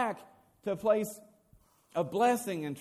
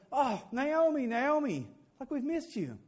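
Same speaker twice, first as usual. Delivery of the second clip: higher, gushing welcome; delighted greeting; 5 semitones higher, slower than usual. Acted. Women running out to Naomi, delighted greeting. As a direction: fuss over a friend come home. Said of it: "to see her and say".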